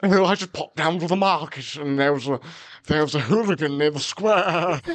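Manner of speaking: put-on prim old-fashioned Brit gossiping; mock old-fashioned British lady; classic British old-fashioned voice. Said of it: "they're like".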